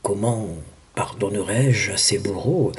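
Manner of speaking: lightly voiced God, low and hushed; God questioning. God to the pleading Virgin about the executioners, hushed question. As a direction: ask it softly and low, with restraint. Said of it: "lui demande".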